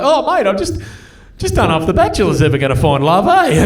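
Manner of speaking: hammed-up, high-pitched blubbering; comic sobbing dad voice; mock bawling. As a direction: fake a tearful wail to the kids. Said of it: "I'm just bawling".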